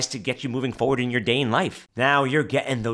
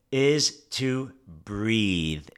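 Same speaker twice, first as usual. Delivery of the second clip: very slow, drawn-out delivery; showing how to slow down; 3 semitones lower, much slower than usual. Shown as a demonstration. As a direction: stretch every word out. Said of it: "as I'm talking slowly".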